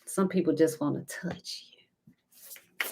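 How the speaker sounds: soft slow whisper. Acted. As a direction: drop to a slow secret whisper. Tarot reader hushes to viewers.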